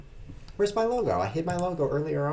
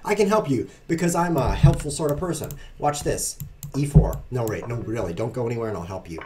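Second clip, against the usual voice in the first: ordinary talk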